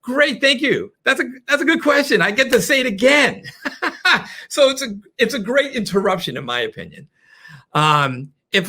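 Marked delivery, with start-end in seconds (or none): 0.0-3.4 delighted. Real, not acted. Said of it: "delighted by interruption". 4.5-6.4 laughing through the words. Real, not acted. Coach laughing about a welcome interruption, chuckling. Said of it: "laughs about interruption".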